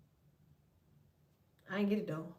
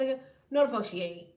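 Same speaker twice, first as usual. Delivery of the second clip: normal talking voice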